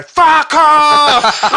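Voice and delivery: huge high-pitched heckling roar; imitated mob shout, drawn out; audience yelling. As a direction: bellow it like a hostile crowd. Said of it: "The entire audience go".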